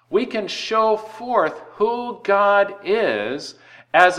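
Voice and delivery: slow, high, rising preacher's fervour; preaching emphasis. Acted. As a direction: draw each word out to lift the church.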